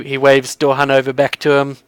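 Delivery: usual speaking voice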